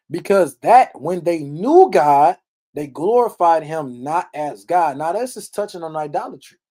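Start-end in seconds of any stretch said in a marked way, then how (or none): 0.1-5.0 slow, louder, deliberate reading; slow scripture reading. Acted. Preacher stressing the verse on idolatry, slow and loud. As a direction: lean on each word of the verse.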